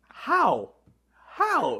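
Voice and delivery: high, baffled "How? How?"; stunned disbelief. Retold or imitated. Friend recalling the stolen car, high shocked disbelief. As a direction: repeat it in rising shock.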